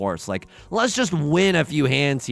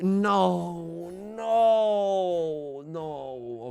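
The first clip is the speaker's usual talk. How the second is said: anguished no; 3 dB quieter, much slower than usual. Real, not acted. Deals into a hand.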